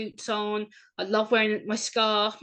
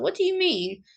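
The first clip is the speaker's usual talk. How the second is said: higher-pitched, put-out questioning; retold indignant surprise; 9 semitones higher, 4 dB louder than usual. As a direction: voice your disbelief at the remark, pitched up. Retold reaction to diagnosis remark.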